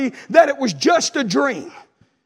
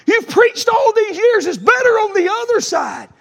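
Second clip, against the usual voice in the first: wife scolding; 8 semitones higher, 4 dB louder than usual. Retold or imitated. Wife scolding him in dream.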